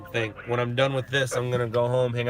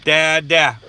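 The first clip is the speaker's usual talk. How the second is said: imitating his son; 7 dB louder, much slower than usual. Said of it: "comes out of my son's mouth".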